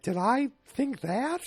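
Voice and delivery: very high, slow puzzled wife impression; Edith. Host as the wife puzzled by her own thought, mock bewilderment. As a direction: draw out the bewildered question. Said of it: "And she's like".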